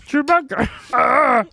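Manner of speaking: Chewbacca roar. Pretend. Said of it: "I can't do Chewbacca".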